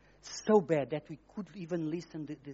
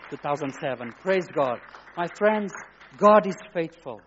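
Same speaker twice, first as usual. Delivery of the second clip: ordinary talk, nothing marked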